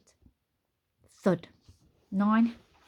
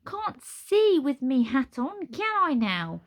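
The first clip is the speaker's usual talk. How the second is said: higher, slow cheeky gargoyle; cockney gargoyle voice; 7 semitones higher, 5 dB louder, slower than usual. Acted. Kaz the gargoyle replies.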